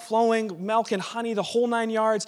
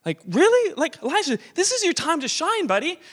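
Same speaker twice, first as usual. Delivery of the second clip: high, louder sarcastic disbelief; pitched-up sarcastic really; incredulous mock complaint; 9 semitones higher, 4 dB louder than usual. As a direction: throw the words up in mock outrage. Voicing Elisha's exasperation.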